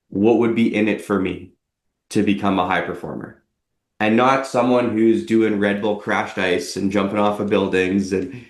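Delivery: ordinary talk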